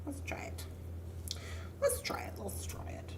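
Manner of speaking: repeated under-the-breath whisper; whispered to herself. Real, not acted. Crafter settling on a design, whispering to herself. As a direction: whisper it over and over while working.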